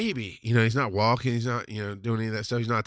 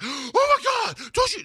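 gasp, then very high mock horror; scandalized wife; slower than usual. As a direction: imitate a shocked wife's high protest. Wife reacts in shock.

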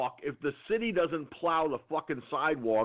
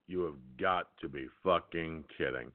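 inner thought; 10 semitones lower, 6 dB quieter than usual. Retold or imitated. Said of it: "I'm thinking to myself".

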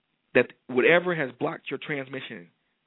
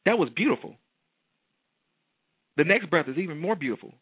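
raised, glowing affirmation voice; uplifting preaching; 4 semitones higher than usual. Acted. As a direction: lift them up with each line.

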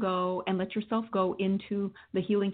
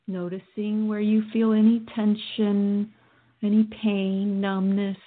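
guided meditation. Acted. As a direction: guide them through calmly.

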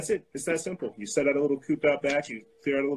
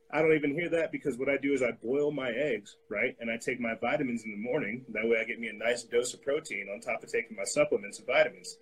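normal talking voice

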